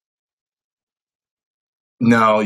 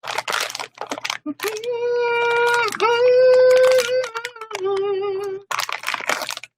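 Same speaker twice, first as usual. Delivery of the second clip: laughing. Real, not acted. Opens stream laughing.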